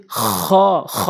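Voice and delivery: খ as a scraped Arabic kh; back-throat kho. Shown as a demonstration. Teacher shows learners the letter, scraped heavy খ. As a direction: rasp each খ at the top of the throat. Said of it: "এভাবে উচ্চারণ করবেন হলকের শেষ".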